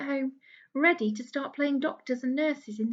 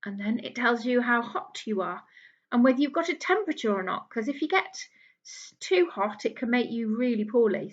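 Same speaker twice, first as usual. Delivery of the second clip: normal talking voice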